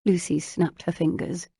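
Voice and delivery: ordinary delivery, nothing marked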